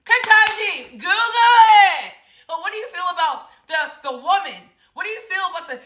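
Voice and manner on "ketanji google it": calling out Ketanji. Retold or imitated. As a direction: call out to her from across the room, urging. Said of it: "That's why I wanna be like".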